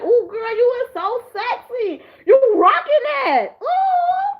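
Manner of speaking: rising to a high excited squeal at the end; swooning admiration. Retold or imitated. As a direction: squeal over her like a fangirl. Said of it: "And I'm just like".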